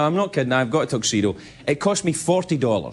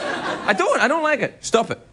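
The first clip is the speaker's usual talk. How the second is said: mock indignation; 8 semitones higher than usual. Comic rant about blue tuxedos.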